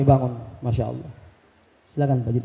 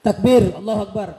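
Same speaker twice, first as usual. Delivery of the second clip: seruan takbir lantang ke jamaah; 5 semitones higher than usual. Real, not acted. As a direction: serukan takbir agar jamaah menyahut. (penceramah mengajak jamaah bertakbir, berseru lantang)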